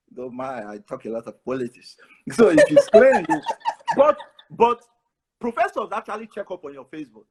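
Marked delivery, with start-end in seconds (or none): 2.3-4.8 laughing at his own joke. Real, not acted. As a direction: break into laughter mid-line. Joke about clean Facebook.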